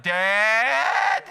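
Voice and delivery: high, loud drawn-out yelp of comic alarm; hammed-up panicked shout; mock alarmed teen. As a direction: cry out in exaggerated disbelief. Voices alarmed Isaac to his father.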